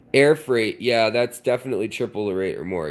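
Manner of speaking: ordinary talk, nothing marked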